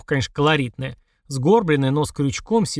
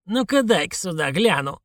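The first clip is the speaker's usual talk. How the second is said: put-on commanding old woman; 4 semitones higher than usual. A narrator's reading. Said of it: "буквально приказала она".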